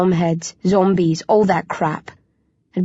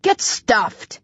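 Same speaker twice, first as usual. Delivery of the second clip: snorting; 3 semitones higher than usual. A narrator's reading. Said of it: "I snort".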